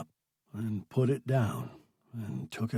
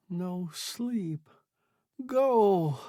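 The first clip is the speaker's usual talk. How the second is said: mouthed horribly; 7 semitones higher, much slower than usual. A narrator's reading. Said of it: "he mouthed horribly".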